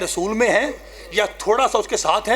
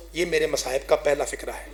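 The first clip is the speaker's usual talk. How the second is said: normal talking voice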